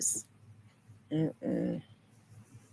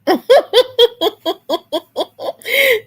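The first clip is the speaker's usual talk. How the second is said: bursting into high laughter; real, loud cackle; 8 dB louder than usual. Real, not acted. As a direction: laugh out hard. Laughing at a viewer comment.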